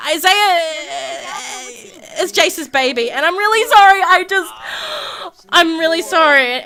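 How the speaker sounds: high, loud mock-tearful apology; acted distraught apology, broken by pauses; tearful confession. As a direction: play it upset, voice lifting as you confess.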